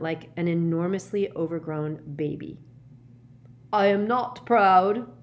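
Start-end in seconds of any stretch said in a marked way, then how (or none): 3.7-5.1 high, slow, anguished confession, voiced; whispered through fingers. A narrator's reading. Professor confesses through his fingers, anguished. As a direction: confess slowly, stressing each word. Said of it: "he whispered through his fingers".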